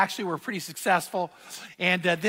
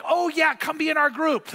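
eager invite; 7 semitones higher than usual. Retold or imitated. Golfers eagerly inviting the pastor.

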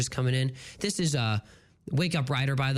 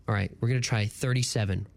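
usual speaking voice

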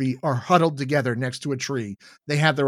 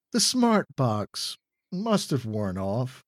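ordinary delivery, nothing marked

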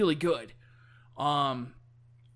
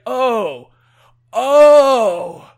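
put-on loud, drawn-out drunk realization; drunk Boozer; 3 semitones higher, 12 dB louder, much slower than usual. Drunk biker explains his mistake to his friend, loud drunken voice. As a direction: play a drunk with a big, booming oh. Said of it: "stumbling around drunk to all these farms".

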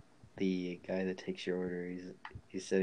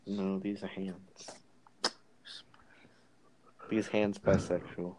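slow deadpan; slower than usual. Pretend. Absurd joke, slow.

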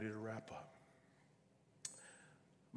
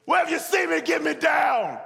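high, full-volume demand; desperate shouting; 18 dB louder than usual. Acted. Dangling man calling up to the voice, desperate shout. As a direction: yell up for rescue.

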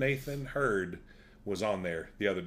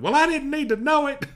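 high, loud, huffy comeback; imitating the wife, pitched way up and louder; indignant retort; 8 dB louder than usual. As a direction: snap back like she's been wronged. Wife's retort in story.

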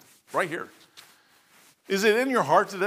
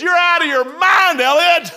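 laughing retort; 10 semitones higher, 7 dB louder, slower than usual. Retold or imitated.

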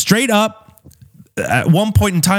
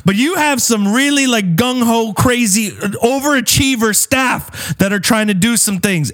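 mild anger; 8 semitones higher than usual. Retold or imitated. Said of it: "And I said".

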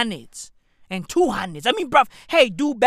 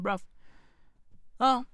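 ordinary talk, nothing marked

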